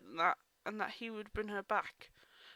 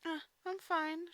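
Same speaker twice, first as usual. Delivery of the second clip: choked; 8 semitones higher, slower than usual. Acted. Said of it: "she choked".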